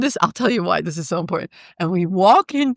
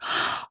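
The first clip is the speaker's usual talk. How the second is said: delighted gasp; 9 dB quieter than usual. Retold or imitated. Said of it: "first words out of his mouth".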